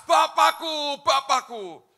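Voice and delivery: loud, high call fading on the second word; retold loud shout to a father; loud cry. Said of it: "Dia teriak keras".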